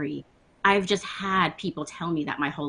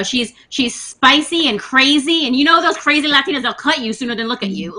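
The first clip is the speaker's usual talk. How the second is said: higher, louder mock warning; 5 semitones higher, 7 dB louder than usual. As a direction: parrot the trope with exaggerated relish. Mimics stereotype about crazy Latinas.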